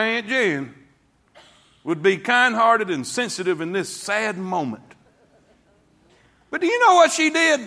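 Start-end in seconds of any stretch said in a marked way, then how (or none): 6.5-7.6 much higher and louder, shouting the story; preaching shout. Acted. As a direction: shout it out to the whole church. Preacher building to a climax.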